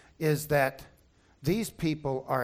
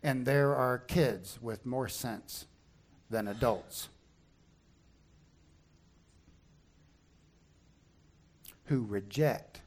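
solemn appeal; 4 semitones lower, 7 dB quieter, slower than usual. Real, not acted. Closing appeal of sermon.

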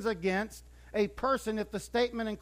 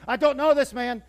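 much louder, higher, blurted insistence; loud denial; 5 semitones higher, 10 dB louder than usual. Retold or imitated. Peter insisting to the crowd he never knew him, loud. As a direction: blurt it out, desperate to be believed.

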